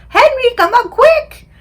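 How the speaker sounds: high, loud, drawn-out call for help; mother yelling urgently for her husband. Acted. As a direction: holler it high and long. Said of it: "And she yells".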